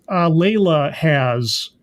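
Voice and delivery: ordinary talk, nothing marked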